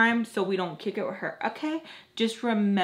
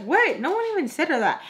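higher, sharp disbelieving protest; indignant disbelief; 8 semitones higher than usual. Retold or imitated. Arguing with her friend at the register, indignant disbelief. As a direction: push back, you can't believe it.

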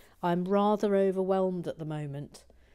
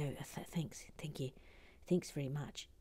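put-on soft, low, hinted New Zealand accent; attempted New Zealand accent; 3 semitones lower, 10 dB quieter than usual. Puppet thanking the host, attempted New Zealand accent. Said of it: "supposed to be a New Zealand accent".

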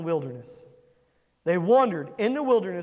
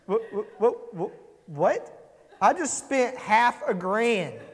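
disbelieving repeated what, slower on the end; shocked dad; slower than usual. Retold or imitated. Father reacts to his daughter's complaint, mock disbelief. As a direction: play the stunned dad who just paid. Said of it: "And he's like".

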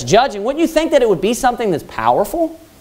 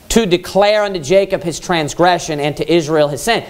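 scripture reading; 5 semitones lower than usual. Acted. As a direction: read the verse out with solemn weight. Rereads the verse.

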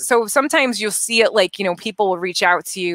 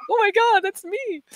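high squeal of disbelief; pitched-up amazed exclamation; mock surprise; 13 semitones higher than usual. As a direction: gasp it out like seeing yourself on a poster. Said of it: "You're like".

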